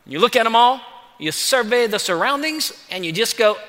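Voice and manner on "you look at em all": ordinary talk, nothing marked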